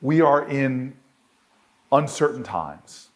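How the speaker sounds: usual speaking voice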